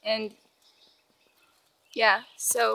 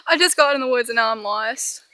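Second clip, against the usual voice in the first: normal talking voice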